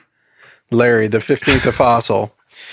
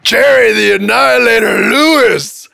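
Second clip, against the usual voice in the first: loud, high ring-announcer call; announcer shout; 9 semitones higher, 5 dB louder than usual. Pretend. Host introducing Jerry the Annihilator, announcer shout. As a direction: boom out the fighter's nickname.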